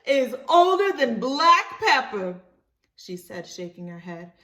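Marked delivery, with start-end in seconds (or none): 0.0-2.4 high, loud, slow scornful voice; mocking woman voice. Virginia mocking Libby's old horse, high loud scorn.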